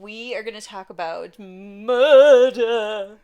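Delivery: slow, loud, eerie announcer voice; spooky October voice. Pretend. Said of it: "It's my spooky October voice".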